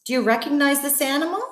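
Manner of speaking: usual speaking voice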